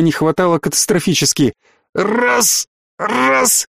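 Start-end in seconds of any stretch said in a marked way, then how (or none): 1.9-3.7 high, strangled syllable, repeated; choked fragments. A narrator's reading. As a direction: force out a broken word while choking. Choking man struggles to speak.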